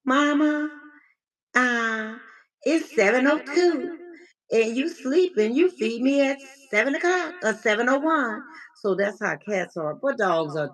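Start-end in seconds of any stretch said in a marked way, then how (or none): 0.0-8.4 high demanding cat voice; whiny hungry cat complaining about breakfast. Retold or imitated. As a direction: play the cat nagging mama. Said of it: "it says".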